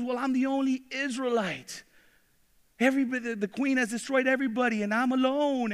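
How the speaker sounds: a frightened prophet spilling out his fears; scared complaint. Acted. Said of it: "Elijah's all freaking out and scared".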